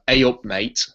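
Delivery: "A up, mate." in Yorkshire dialect; Yorkshire greeting. Shown as a demonstration. Brit teaching Americans the Yorkshire greeting. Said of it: "over here we have".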